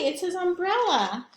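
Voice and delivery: ordinary delivery, nothing marked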